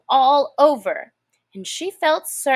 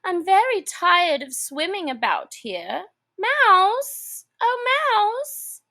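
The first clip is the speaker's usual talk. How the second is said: put-on higher, girlish Alice voice asking the mouse; a child calling politely to a mouse; Alice, polite little girl; 8 semitones higher than usual. A narrator's reading. Alice calls to the mouse in a high girlish voice.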